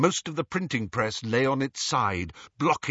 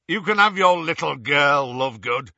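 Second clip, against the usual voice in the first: loud, rough captor's offer; menacing bargain; 2 semitones higher, 7 dB louder than usual. A narrator's reading. Captor threatens the desperate father.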